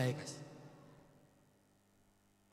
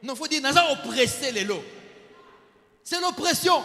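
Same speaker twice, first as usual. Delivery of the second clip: loud, higher whiny protest; mock complaint; 5 semitones higher, 6 dB louder than usual. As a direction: ham up the grumbling excuse. Preacher mocks the complaint.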